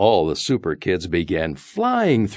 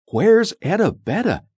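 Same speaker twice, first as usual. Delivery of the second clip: high, louder puzzled asking; puzzled question; 14 semitones higher, 5 dB louder than usual. A narrator's reading. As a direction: wonder aloud where the friend went. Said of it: "asked all the Super Kids".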